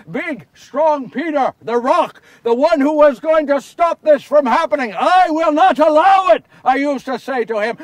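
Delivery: loud, scornful build to a shout; bitter self-mockery. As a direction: throw your old boasts back at yourself. Bitterly mocks his own boasts.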